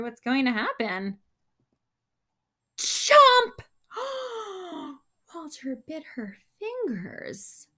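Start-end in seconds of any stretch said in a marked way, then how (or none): 2.8-3.6 high, loud snapping chomp. Acted. Goldfish chomping Penelope's finger, loud chomp. 3.9-4.9 shocked gasp. Acted. Bitten, shocked.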